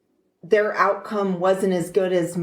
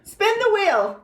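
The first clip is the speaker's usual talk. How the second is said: game show host; 11 semitones higher than usual. Pretend. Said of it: "game show host".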